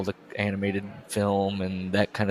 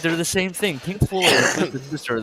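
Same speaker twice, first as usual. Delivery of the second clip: mock insistent; 7 semitones higher, 5 dB louder than usual. Acting out his own confusion.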